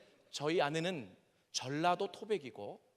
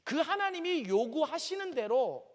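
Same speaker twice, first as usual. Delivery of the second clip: raised, higher preacher's delivery from the pulpit; preaching at full force; 6 semitones higher, 4 dB louder than usual. Acted. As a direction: press the point home like a preacher. Preaching to the congregation. (설교하듯 힘주어)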